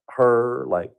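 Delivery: ordinary talk, nothing marked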